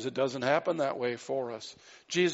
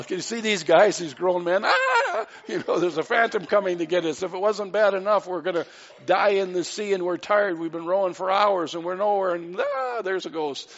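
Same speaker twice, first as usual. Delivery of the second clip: louder, higher mock terror with laughter; hammed-up panic; 4 semitones higher, 5 dB louder than usual. Preacher acting out frightened disciples in the boat, hammed-up louder panic. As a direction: play the panicked sailors for laughs. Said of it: "they're just screaming".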